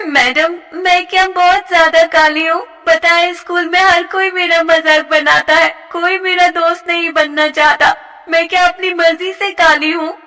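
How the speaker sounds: put-on hurt young girl. A teased girl complaining to her teacher, upset.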